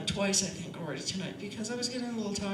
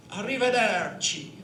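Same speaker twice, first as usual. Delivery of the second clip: loud Italian flourish with long vowels; Italian accent; 8 dB louder than usual. Shown as a demonstration. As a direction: open up wide on a loud, hammed-up Italian farewell. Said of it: "Or Italy".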